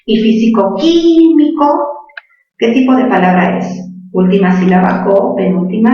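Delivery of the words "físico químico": físico-químico with a long, high QUÍ; stress on QUÍ. Shown as a demonstration. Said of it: "antepenúltima qui". (físico-químico alargando la sílaba QUÍ)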